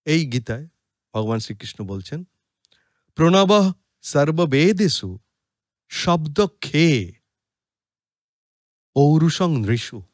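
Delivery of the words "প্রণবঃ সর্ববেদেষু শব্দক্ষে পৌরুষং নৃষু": slow, measured chant of the shloka; Sanskrit verse recitation. Acted. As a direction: recite the verse slowly and solemnly.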